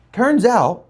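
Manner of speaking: ordinary talk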